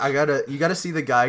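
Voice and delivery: ordinary talk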